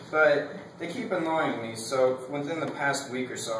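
ordinary delivery

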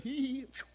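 squeaky mock giggle then a puff of relief; high giggle and puff. Pastor voicing the boy in his story, a high giggle. Pastor acts out the boy's reaction.

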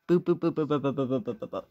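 mouth-made dial tone, fading out; little boop-boop phone sounds trailing off; phone dialing noise. Pretend. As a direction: imitate a phone ringing out with boops. Calling Fiery on the phone.

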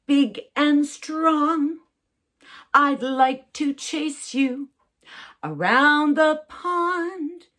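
sung slow and high as a T-Rex; T-Rex song. Shown as a demonstration. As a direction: sing it like a big dinosaur. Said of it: "become a Tyrannosaurus Rex".

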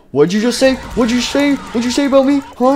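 high, loud confrontational yell; angry Bonnie. Acted. Bonnie confronting someone who insulted him. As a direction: get in their face, menacing.

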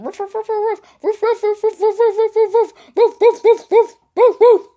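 barking dog. Retold or imitated. Dog barking at her from a twin's house, barking. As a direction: bark like a worked-up dog. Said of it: "It started barking".

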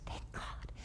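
breathed relief, no voice; a mom whispering relief as her son finally leaves; relieved whisper. Retold or imitated. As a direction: whisper your thanks under your breath.

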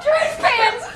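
high, slow, laughing yell; laughing shout. Real, not acted. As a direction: crack up while you shout it. Laughs at opponent's pants.

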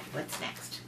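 low hushed whisper. Acted. Low whispered page turn.

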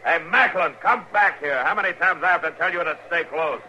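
exasperated yell at a straying prisoner; angry shout. Acted. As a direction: call him back sharply across the ice. Marshal yells at drifting prisoner.

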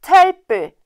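high, loud insult; scornful shout. A narrator's reading. Brothers see the simpleton's second find, shouting scornfully. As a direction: yell the jeer at him.

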